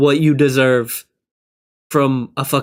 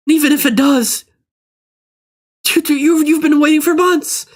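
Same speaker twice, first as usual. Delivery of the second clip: escalating rant; 12 semitones higher than usual. Real, not acted. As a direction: build to a high shrill rage. Rant building to screaming.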